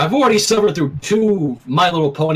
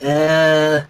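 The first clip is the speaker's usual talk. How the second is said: dismissive; 3 semitones lower than usual. A narrator's reading. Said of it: "Rainbow said dismissively".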